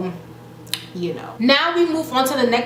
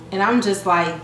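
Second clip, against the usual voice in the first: ordinary talk, nothing marked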